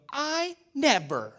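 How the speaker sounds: high, slow, drawn-out mom voice; mom's exasperated I never. Retold or imitated. Preacher imitating his mom when the kids misbehave, high and drawn out. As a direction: imitate a fed-up Southern mom trailing off. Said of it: "my mom would look at us and she'd go".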